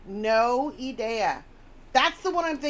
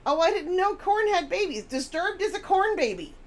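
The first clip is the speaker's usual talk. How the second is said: higher, louder amazed gasp of news; mock discovery; 9 semitones higher, 5 dB louder than usual. Streamer mocking a friend's surprise at band lineage. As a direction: act thrilled to learn it.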